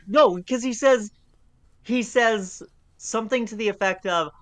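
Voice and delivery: ordinary delivery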